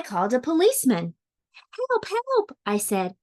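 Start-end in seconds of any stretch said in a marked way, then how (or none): 1.8-2.6 high-pitched storybook plea for help; cry for help. A narrator's reading. Child phones a policeman about the fish, acted alarm. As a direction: call for help in a much higher voice.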